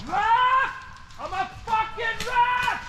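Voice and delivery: shouting. Real, not acted. Excited yell during airsoft game.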